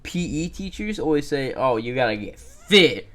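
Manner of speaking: loud high ranting; excited rant. Real, not acted. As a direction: rant at full energy. Ranting about PE teachers.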